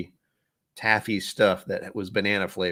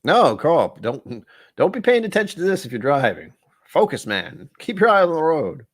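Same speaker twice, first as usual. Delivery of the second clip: louder, higher call to a friend; 5 semitones higher, 6 dB louder than usual. Real, not acted. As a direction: warn Carl off his phone, loud and higher. Calling out to a viewer who drives.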